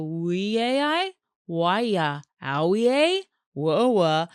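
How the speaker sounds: slowly sounding out We A I, Why ya, Owie a as guesses; puzzling over the brand name out loud; fumbling pronunciations. Retold or imitated. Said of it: "trying to go".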